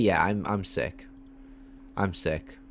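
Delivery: ordinary delivery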